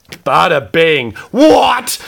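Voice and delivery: loud, high yelp of surprise; overexcited outburst. Real, not acted. Collector opening a great card, loud high yelp. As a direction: jump up into a stunned shout. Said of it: "Calm down".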